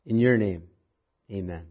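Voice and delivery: soft, hushed prayer voice. Acted. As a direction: pray quietly and reverently. Opening prayer before sermon.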